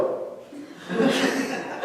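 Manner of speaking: breathy burst of laughter; a real laugh at his own nickname; airy laugh. Real, not acted. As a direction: laugh off the memory.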